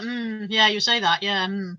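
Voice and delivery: a lightly voiced wary driver humouring her; unconvinced driver. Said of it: "all the drivers are like".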